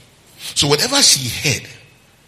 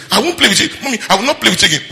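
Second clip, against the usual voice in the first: put-on higher, fast pouting child; imitating sulky child; 9 semitones higher, 4 dB louder, much faster than usual. Pastor imitating a child refusing to play with mommy. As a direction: rattle it off like a cross toddler. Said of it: "The child is collecting food and say".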